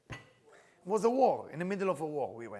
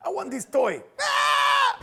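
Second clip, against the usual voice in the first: loud, high tantrum screams; toddler screaming; 13 semitones higher, 9 dB louder, much slower than usual. Acted. Kid in a toy store demanding a toy, screaming tantrum. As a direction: scream for the toy like a small child.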